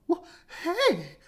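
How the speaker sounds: huffy chicken; indignant hen. Acted. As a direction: scold the intruder like a prim chicken. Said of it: "said Chicken Licken".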